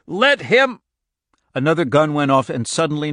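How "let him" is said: high, loud shout broken off; bellow, then cut off; bull-throated shout. A narrator's reading. Irv cut short by a gunshot, bull-throated shout. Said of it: "bull-throated".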